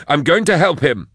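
high, loud, urgent; determined. Acted. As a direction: declare it while rushing off. Determined, rushing.

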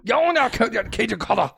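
high put-on Cajun slogan; Cajun accent. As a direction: hype it up in mock Cajun. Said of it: "Say their slogan in their legal voice".

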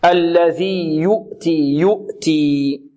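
very slow الذي يؤتي with a pure "u"; rounded damma. Shown as a demonstration. Teacher drilling lip rounding, slow clear damma. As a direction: hold rounded lips on the "yu" of يؤتي. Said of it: "إلا بضم الشفتين ضمة".

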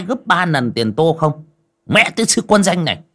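high, loud berating of a servant; angry master scolding. Acted. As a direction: tear into the maid like a stingy master.

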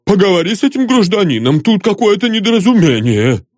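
quick, puffed high-pitched plea; breathing heavily. A narrator's reading. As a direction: hand it over, winded and rattled. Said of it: "тяжело дыша".

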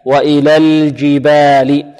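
slow tajweed demo of الجبال, vowels drawn out; Quranic recitation. Shown as a demonstration. Teacher models the verse for students, measured recitation. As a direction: recite الجبال slowly with a medium, unthickened lam. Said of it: "واللام هنا متوسطة".

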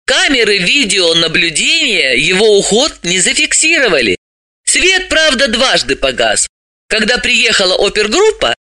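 a somewhat high reporting voice; animated female character, reporting. Acted. Voices Dalila reporting the case.

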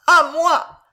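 high, loud, sudden scream; desperate, piercing call; agonised cry for help. A narrator's reading. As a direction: cry out as if dying. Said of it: "râla tout à coup Jeanne d'Albret".

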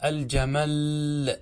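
"الجمل" said al-jamall, the last l held long; doubled final lam. Shown as a demonstration. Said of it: "بتشديد اللام".

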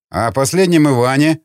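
plain reading voice

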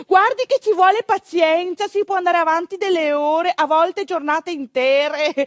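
a midwife patiently warning the nervous husband. Retold or imitated. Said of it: "gliel'ha detto e ripetuto".

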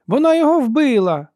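very high, loud exclamation; disappointed shout. A narrator's reading. Sister sees soldier fall, shouting in disappointment. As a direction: yell it with a letdown. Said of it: "Голос жінки звучав дещо розчаровано".

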